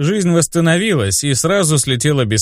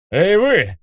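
calling out; 6 semitones higher than usual. Acted. Hero calls out to attackers.

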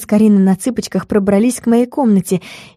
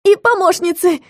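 high, quick added call; light high voice, nudging the crowd; prompting; 9 semitones higher than usual. A narrator's reading. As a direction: chime in with a high-pitched prompt. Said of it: "подсказала я".

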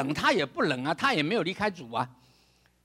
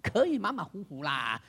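careless shrug; 7 semitones lower, 4 dB quieter than usual. Retold or imitated. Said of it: "老师说".

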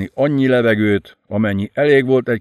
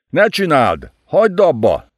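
a louder, higher yell; 6 semitones higher, 3 dB louder than usual. Acted. Laskili yells at Enid to stop. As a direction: bellow at her to stop. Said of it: "üvöltötte Laskili".

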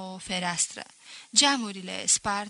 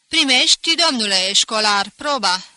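teacher challenging the pupil; mocking challenge; 12 dB louder than usual. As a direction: throw the challenge at him with mock courtesy.

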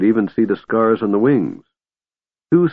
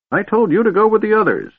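put-on very high-pitched cranky old man; annoyed scolding. A narrator's reading. Old man scolds dragon for staying, annoyed.

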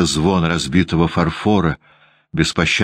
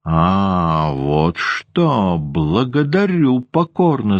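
злая язвительная старуха, выше и медленно; процеди с ядом, будто шипит; злое шипение; 2 semitones higher, much slower than usual. A narrator's reading. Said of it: "зашипела Анна Григорьевна". (воспитательница шипит на Ванду, злобно и язвительно)

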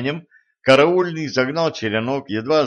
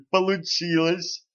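delighted; 7 semitones higher than usual. A narrator's reading. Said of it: "обрадовался караульный".

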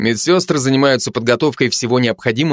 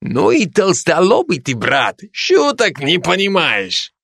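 put-on higher Georgian-accented chiding, slowing down; 6 semitones higher, slower than usual. Georgian soldier scolding the slow Ukrainian, in a Georgian accent. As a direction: chide him slowly in a Georgian accent. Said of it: "каждый со своим акцентом".

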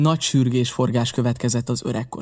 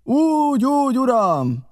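high, loud, drawn-out answer; men hollering assent; shouted reply; 12 semitones higher, 4 dB louder, slower than usual. A narrator's reading. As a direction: call back like several eager henchmen. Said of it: "válaszoltak ketten-hárman".

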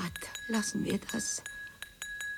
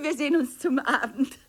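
ordinary talk, nothing marked

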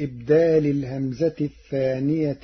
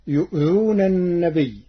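chanted verse, higher and louder; Quran recitation; 4 semitones higher, 5 dB louder than usual. Acted. Reciter chanting the verse before naming Warsh's reading. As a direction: intone the verse.

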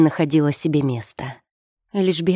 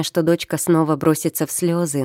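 ordinary delivery

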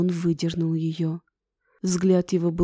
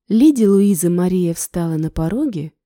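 ordinary delivery, nothing marked

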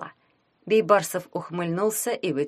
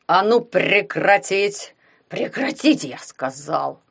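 loud, hoarse order to stop; hoarse command; 4 dB louder, slower than usual. A narrator's reading. Someone breaks up the duel, commanding hoarsely. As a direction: order it hoarsely, leaning hard on the words. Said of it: "хрипло приказал кто-то".

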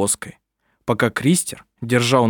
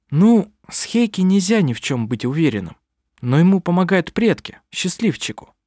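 higher-pitched, mildly envious mother; a touch of envy; 6 semitones higher than usual. A narrator's reading. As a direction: let slight envy creep in, pitched up. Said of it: "Я слышу в твоём голосе зависть". (с лёгкой завистью)

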